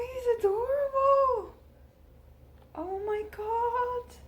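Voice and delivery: high, slow, near-tearful gushing; overjoyed cooing. Real, not acted. Unwrapping a handmade squirrel from Carol, high emotional gushing. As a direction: melt over a cute gift.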